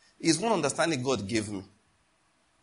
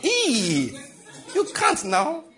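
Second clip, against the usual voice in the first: high squealing laugh, louder; 11 semitones higher, 4 dB louder, much slower than usual. Real, not acted. Preacher laughing at a father denying his money. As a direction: laugh it off in disbelief, much higher.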